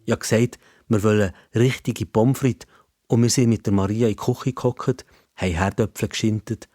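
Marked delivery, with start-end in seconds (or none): none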